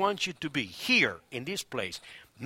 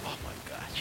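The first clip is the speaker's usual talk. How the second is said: hushed, halting disbelief; awed whisper; 12 dB quieter than usual. Acted. Peter hearing that Jesus wants him, awed whisper. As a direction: whisper it as if hardly daring to believe.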